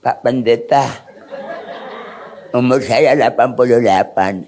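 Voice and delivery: low-pitched elderly man impression; comic aged-pastor voice; retired old pastor. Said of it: "Ada pendeta yang sudah pensiun".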